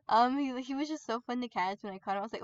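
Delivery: ordinary talk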